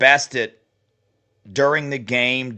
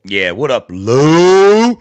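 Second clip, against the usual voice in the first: heckling a rival coach; mock greeting; 11 dB louder, much slower than usual. Real, not acted. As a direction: shout out Lou with a jeer. Calling out Lou Holtz mockingly.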